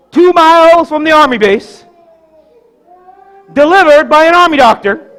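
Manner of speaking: high, loud, emphatic pulpit delivery; preach. Acted. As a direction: proclaim each phrase, with big pauses between. Preacher storytelling at full force.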